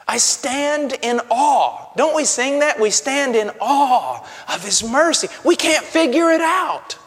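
slow awe swelling to a loud, high cry; preacher climbing from hushed awe into a full shout; building to a shout. Real, not acted.

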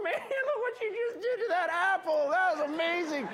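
high, laughing delight at the apple; delighted praise. Retold or imitated. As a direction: gush over it, laughing.

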